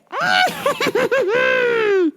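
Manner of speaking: long high shrieking cackle; manic cackle. Retold or imitated. She comes in the store, manic high cackle. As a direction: mimic a crazed, shrieking laugh. Imitates the woman's outburst in store.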